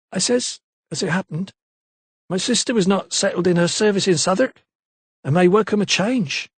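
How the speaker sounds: a lightly voiced London maid, unsure; maid's voice. A narrator's reading. Maid Eliza's voice.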